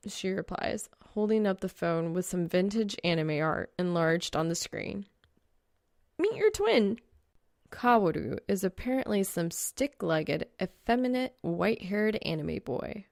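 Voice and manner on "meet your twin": put-on higher, brighter girl voice; light teasing girl voice; Reaper's voice. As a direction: voice the girl character up high. Female character teasing.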